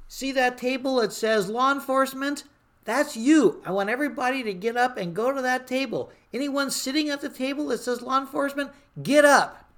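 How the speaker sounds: high, loud crowd directions; calling out orders. Retold or imitated. Speaker sending police officers to their table, loud call. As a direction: herd the room like a dispatcher.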